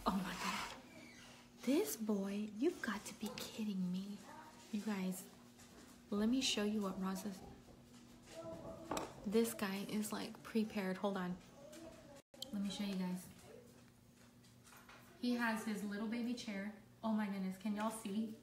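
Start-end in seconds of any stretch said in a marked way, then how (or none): 1.7-4.2 unvoiced whisper. Real, not acted. Filming her baby at the door, hushed whisper. 4.7-18.3 hushed. Real, not acted. Showing viewers the baby quietly, hushed voice.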